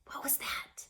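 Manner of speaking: hushed, startled whisper in the dark; frightened whisper. A narrator's reading. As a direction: breathe it out, scared of the noise.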